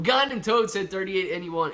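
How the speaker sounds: normal talking voice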